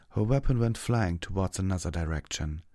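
ordinary delivery, nothing marked

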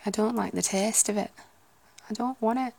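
lightly done Lancashire voice with a flat A in "taste"; a hint of Lancashire accent. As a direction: put on a northern Lancashire grumble. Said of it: "supposed to be somewhere in Lancashire".

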